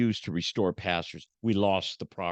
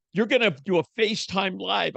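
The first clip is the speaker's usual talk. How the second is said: higher bossy wife imitation; wife's order; 8 semitones higher, 3 dB louder than usual. Wife telling husband to go live, higher bossy voice. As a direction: lay down the law like his wife. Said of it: "She goes".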